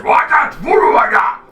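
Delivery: high, loud bickering giant head; two bickering giant voices. Acted. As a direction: shout back like the other giant head. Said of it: "And the other head says".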